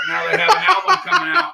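high, fast, laughing through it; giggling while joking. Real, not acted.

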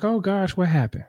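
lightly mimicked high-voiced motherly concern; high, babying worry, retold; fussing stepmom. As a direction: imitate a fussing stepmother, pitched well up. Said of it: "She's trying to baby me".

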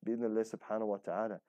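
ordinary talk, nothing marked

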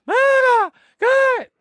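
high, loud calling out; retold yell. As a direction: yell it high across the room. Retold shouting scene, father arrives.

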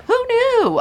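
very high squeal; squeaky high. Pretend. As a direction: squeak it out in fun. Squeaky joking exclamation.

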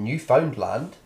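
"Newfoundland" as three separate words, New Found Land; three distinct words. Shown as a demonstration. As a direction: say "Newfoundland" slowly with a full "found". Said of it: "as if it were, were three distinct words".

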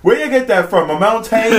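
high, loud sassy comeback; hammed-up sassy server, laughing through it; mock server. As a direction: scoff at him like a rude server. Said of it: "she was like".

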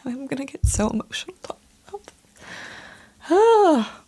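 fighting back tears, then a choked high sob; happy tears. Real, not acted. Runner recalling her first race, voice breaking with happy tears. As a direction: voice cracking as happy tears start. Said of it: "These are happy tears".

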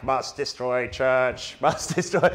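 activated sleeper cell chanting its mission; robot sleeper agent. Retold or imitated. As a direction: drone it like a programmed agent. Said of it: "It's like".

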